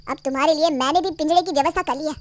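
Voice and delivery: normal talking voice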